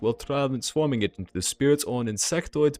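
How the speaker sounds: put-on deliberate German-accented envoy. Dragon's envoy confirms the elf's account, measured German accent. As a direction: draw out the opening in a German accent. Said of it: "deep, melodious German accent".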